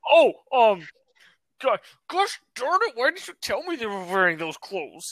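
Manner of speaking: mock a flustered, mild-swearing friend; Josh. Imitating a friend shocked at the maid cafe, mock complaint. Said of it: "That would be him in his very Josh voice".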